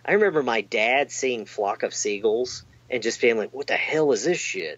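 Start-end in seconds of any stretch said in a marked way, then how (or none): 3.5-4.8 slightly higher Southern dad voice; Southern accent. Retold or imitated. Dad reacting to his kid's music video, Southern accent. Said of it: "With the Southern accent you had going there".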